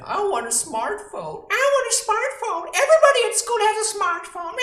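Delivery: high, loud, fast nagging whine; whining child. Retold or imitated. Child demanding a smartphone, loud high whine. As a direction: nag like a spoiled kid. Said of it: "When you came and said".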